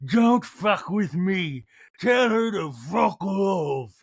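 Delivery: put-on slow, slurred drunken shout; hammered and picking a fight; drawn-out drunk yell. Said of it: "she was hammered going".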